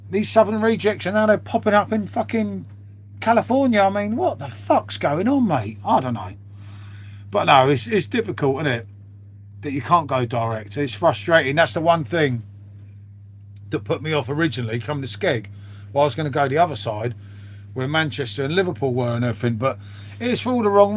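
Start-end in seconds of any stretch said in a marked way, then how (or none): none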